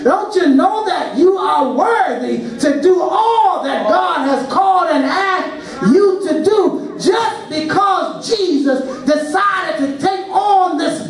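rising, urgent preacher's call; preaching at full force. Real, not acted. Preacher rousing the congregation, fervent. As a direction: drive the question home to the church.

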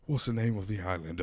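ordinary delivery

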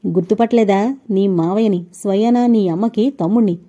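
plain reading voice

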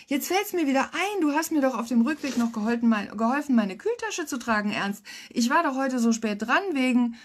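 high, gushing babble; eager chatter. A narrator's reading. Edelgard reminding Ernst how he helped her, eager. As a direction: gush on without stopping for breath. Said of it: "rief Edelgard eifrig".

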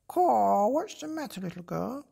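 high, loud cawing crow. Acted. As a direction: caw like a curious bird. Crow character speaks.

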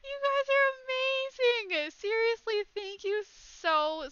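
high, tearful squeal of gratitude; choked-up happy squeal, settling gradually; overwhelmed, near tears. Real, not acted. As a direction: gush thanks in a high voice. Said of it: "I'm gonna cry".

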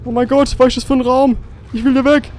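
high-pitched, frightened voice; scared. Real, not acted. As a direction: panic creeping in. Creepy room, wants to leave.

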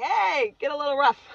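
high, loud joking call; loud, jokey come-on; playful egging on. Retold or imitated. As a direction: call it out cheekily, about to laugh. Jokingly quoting herself in bedroom.

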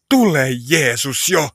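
loud, drawn-out prayer call; crying out. Acted. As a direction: call out slowly and firmly in prayer. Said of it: "jossa me huudetaan". (kova, venytetty rukoushuuto)

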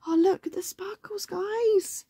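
high, wondering coo. Pretend. Reader shows listeners the sparkly picture, cooing. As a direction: show it off in a very high voice.

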